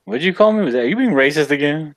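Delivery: mock outrage. Friend teasing Hillel after the kettle joke, mock outraged. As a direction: pretend to be shocked by the insult.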